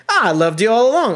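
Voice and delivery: loud, high-pitched hearty declaration; mock effusive affection. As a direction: gush it out like an old pal. Acts Pope embracing Carver, laughing together.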